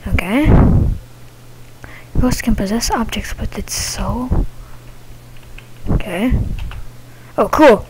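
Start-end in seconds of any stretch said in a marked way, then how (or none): 2.2-4.5 whispering. Real, not acted. Reading game text in whisper.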